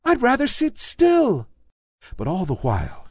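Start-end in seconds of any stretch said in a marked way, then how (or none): 0.0-1.5 high, small rabbit voice; little toy rabbit. A narrator's reading. Toy rabbit insisting to the wild rabbit, high small voice. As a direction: pipe up like a tiny toy bunny. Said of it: "he said".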